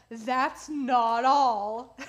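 slow, drawn-out salesman pitch; infomercial voice. Pretend. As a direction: sell it like a TV pitchman. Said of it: "I sound like an infomercial".

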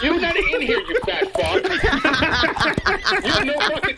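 heated, half-laughing jab; angry laughing insult. Real, not acted. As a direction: snap it out through a laugh.

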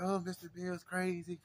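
high-pitched child impression; imitated little boy. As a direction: exclaim like an amazed small kid. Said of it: "He's like".

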